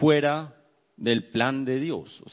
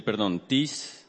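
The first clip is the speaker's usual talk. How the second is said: ordinary talk, nothing marked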